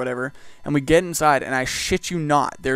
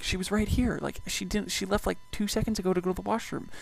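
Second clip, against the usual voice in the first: higher, softer flustered explaining; 6 semitones higher, 7 dB quieter than usual. Retold or imitated. Worker explains to ambulance crew where she went, flustered. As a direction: raise the pitch and soften, mock helpless. Flustered retold reply to paramedics.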